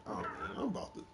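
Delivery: breathy near-whisper, almost no voice; whispered dread; hushed aside. Retold or imitated. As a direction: mutter it under your breath in disbelief. Quoting own reaction mid-fight.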